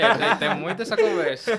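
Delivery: falando alto e rindo, mais agudo. Real, not acted. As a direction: risada solta no meio da frase. Risada após a piada da jaca. (rindo alto, voz mais aguda)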